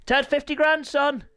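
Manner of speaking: high, loud playful demand for payment; mock demand. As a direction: call in a debt loudly, in jest. Mock demand for owed money.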